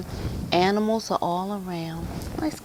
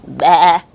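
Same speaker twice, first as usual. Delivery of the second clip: lamb bleat; 10 dB louder than usual. Acted. Lamb with its sound restored, loud bleat. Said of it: "said the lamb".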